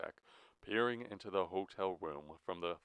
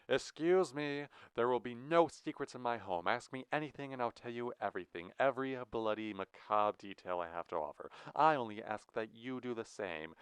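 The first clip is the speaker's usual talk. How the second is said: higher-pitched, theatrically polite reprimand; affronted villain host; 5 semitones higher than usual. Acted. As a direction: interrupt like an offended host. Lloyd indignantly interrupts their conferring.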